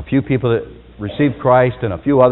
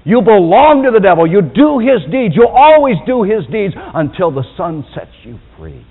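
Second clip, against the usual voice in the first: loud, blunt pronouncement; stern uncompromising rebuke; cold, uncompromising Jesus; 7 semitones higher, 7 dB louder than usual. Retold or imitated. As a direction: lay down judgment without softening. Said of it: "He's cold. He's uncompromising".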